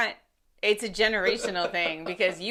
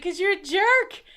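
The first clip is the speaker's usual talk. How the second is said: mock insult; 9 semitones higher than usual. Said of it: "And it's like".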